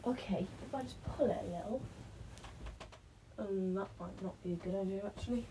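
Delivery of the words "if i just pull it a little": normal talking voice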